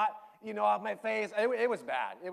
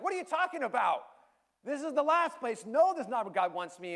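higher, louder mock-angry protest; retold anger; 9 semitones higher, 6 dB louder than usual. To his coach, angrily rejecting the idea, raised voice. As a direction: push back, indignant and raised. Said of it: "my first response was kinda anger".

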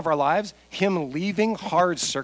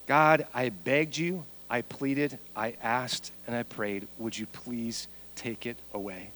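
slow plea, fading much softer and lower; soft pleading; 4 semitones lower, 5 dB quieter, slower than usual. Acted. Preacher voicing Paul begging God, softly pleading. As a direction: beg quietly, sinking almost to nothing.

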